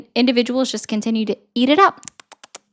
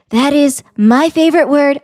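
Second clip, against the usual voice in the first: Donald Trump impression; 2 semitones higher, 4 dB louder than usual. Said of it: "he said".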